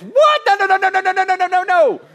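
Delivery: high, loud, rapid-fire mock panic; comic parental panic. Parent silencing child's embarrassing story, panicked high shouting. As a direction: shriek it like a parent desperate to cut the child off.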